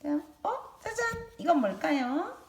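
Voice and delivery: high, loud playful reveal; reading to a toddler. Acted. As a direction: surprise them with a big ta-da. Shows object to young kids.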